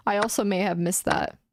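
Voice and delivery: ordinary talk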